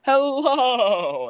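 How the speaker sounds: loud, high announcer hello; big show intro. Acted. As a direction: belt out the welcome like a host. Podcast host's big opening greeting.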